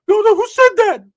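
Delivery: high, loud, whiny cousin voice; Ricky's voice. Retold or imitated. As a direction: squeak it out like a worried goofball. Ricky objecting in dialogue.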